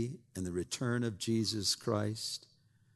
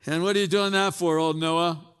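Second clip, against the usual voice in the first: high, loud jeering voice; shouted mockery of a scoffer; mocking townsman; 8 semitones higher, 10 dB louder than usual. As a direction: taunt Noah from the crowd. Townspeople mock Noah.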